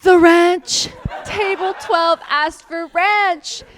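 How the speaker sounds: loud, slow horrified realization; sudden panic. Real, not acted. Server in bed remembering a forgotten ranch order, loud and panicked. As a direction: jolt upright remembering.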